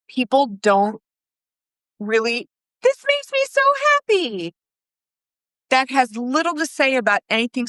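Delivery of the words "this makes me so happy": very high-pitched happy squeal; mock gushing. Guest praising the organizer's work, mock gushing. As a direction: gush up high like an overjoyed guest.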